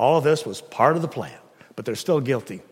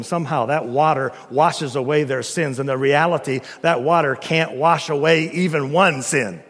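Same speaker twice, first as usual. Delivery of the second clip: ordinary talk, nothing marked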